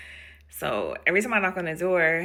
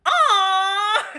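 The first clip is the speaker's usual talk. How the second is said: loud, high drawn-out aw; acted gushing aww; touched; 13 semitones higher, 7 dB louder than usual. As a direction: melt into a long high coo. Acts touched reaction to patient.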